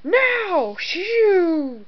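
high, loud attack cries; battle yell. Acted. Battle yells in play.

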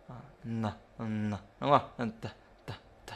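N held long, then short unvoiced T puffs; âm n, t rời. Shown as a demonstration. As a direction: sound out N and T alone, tongue behind the teeth. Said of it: "để lưỡi sau răng". (đọc rời âm n và t, lưỡi sau răng)

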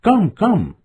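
high, thin bird-like call; high twittering summons; thin, tender call. A narrator's reading. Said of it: "thin as the bird's twittering and tender".

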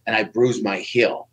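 ordinary talk

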